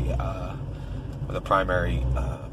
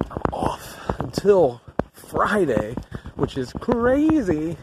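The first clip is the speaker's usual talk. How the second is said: excited; 8 semitones higher, much slower than usual. Real, not acted. Excited about days off.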